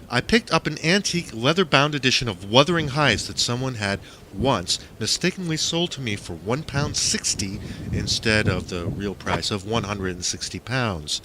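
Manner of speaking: ordinary delivery, nothing marked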